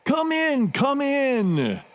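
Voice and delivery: very high, drawn-out singsong greeting; effusive welcome. Acted. Doctor welcoming visitors to his estate, effusive. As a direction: usher guests in with delighted, high-pitched calls. Host welcomes arriving guests.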